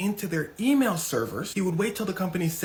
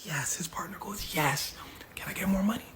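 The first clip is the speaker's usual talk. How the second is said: soft, whispery asking; hushed scheming; 7 dB quieter than usual. Retold or imitated. Partner quietly asks Hush Puppy for more, hushed. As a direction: sneak it out under the breath. Conspirators celebrate quietly, first ask.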